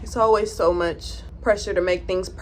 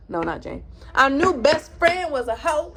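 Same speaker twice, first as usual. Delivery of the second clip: usual speaking voice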